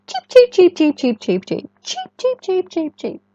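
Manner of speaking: put-on squeaky high baby-bird peeps; piping, high chick calls; high chick cheeps. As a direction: chirp them like tiny ducklings. Ducklings calling, chick cheeps.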